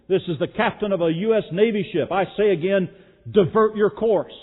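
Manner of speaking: loud, high, insistent navy captain; commanding shout. Retold or imitated. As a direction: bark the order over the radio. Captain insists over radio.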